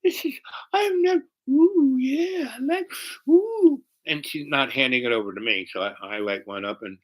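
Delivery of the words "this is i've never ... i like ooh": high cooing mock rapture; delighted girl. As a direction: swoon in falsetto, breaking off into oohs. Girl enjoying the joint.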